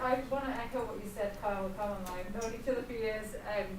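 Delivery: normal talking voice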